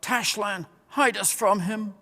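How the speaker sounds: frightened cry. A narrator's reading. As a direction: cry out to be hidden. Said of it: "moaned and howled and called out".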